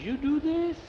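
very high, rising disbelief; amazed question. Acted. Jose asks Jackie who fixed the rocker, amazed. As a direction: squeak it upward in happy astonishment.

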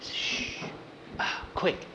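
hushed, breath-only command; secretive whispered urgency; urgent whisper. Acted. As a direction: shush and whisper to act quickly. Hiding, hushing friend urgently.

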